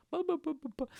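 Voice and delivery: high sing-song ba ba; mock babbling; silly high babble. Real, not acted.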